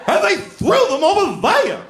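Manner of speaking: wildly over-the-top, high, wailing impression; Ray Bolger's Scarecrow. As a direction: ham up an upset victim, slow and high-pitched. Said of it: "this performance that's way over the top".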